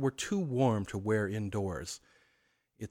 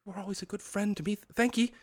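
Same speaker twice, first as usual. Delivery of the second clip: put-on nervous old man; 11 semitones higher, faster than usual. Scrooge stammers thanks.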